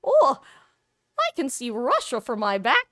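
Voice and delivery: high, loud folksy Sarah Palin voice; chirpy, bright-eyed mock Palin; exaggerated folksy politician quip; Sarah Palin impression. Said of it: "you think of Sarah Palin".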